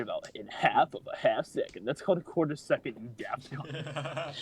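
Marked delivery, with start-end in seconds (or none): 1.9-4.4 snarky put-down. Acted. Mocking insult in voice.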